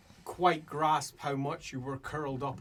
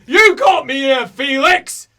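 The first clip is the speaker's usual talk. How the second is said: high, full-volume accusing yell; angry shout; 12 semitones higher, 21 dB louder, slower than usual. Acted. Character blaming Felix, furious roar. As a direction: roar the blame at them.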